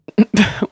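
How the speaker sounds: loose, giggly burst of laughter; drugged giggle. A narrator's reading. Drugged patient giggling at his friend's question. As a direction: let a giggle spill out. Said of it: "Sam burst into laughter".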